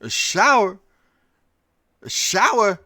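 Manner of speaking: mock surprise. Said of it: "He goes".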